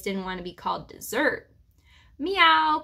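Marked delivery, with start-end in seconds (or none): 2.2-2.8 high, loud meow; a short, attention-seeking cat call; jealous cat's meow. A narrator's reading. Cat jealous of the hamster's attention, meowing. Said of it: "called Sweetums, obviously jealous of the attention".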